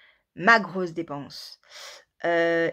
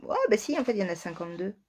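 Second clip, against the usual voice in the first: usual speaking voice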